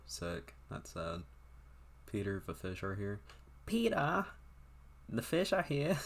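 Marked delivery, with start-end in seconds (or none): 3.7-6.0 high-pitched put-on British woman; British accent. Woman calling Peter about the fish, mock British accent. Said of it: "I don't know why I made her sound British".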